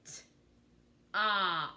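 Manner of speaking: "T-op" with a gap after the T; sounded out. Shown as a demonstration. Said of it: "I'm gonna sound out those words".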